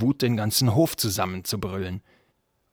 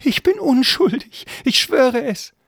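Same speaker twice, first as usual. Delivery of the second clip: very high, loud pleading; desperate servant; 9 dB louder, slower than usual. Acted. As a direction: swear innocence in a squeaky, panicked voice. Servant swears his innocence. (verzweifelt hohes Beteuern)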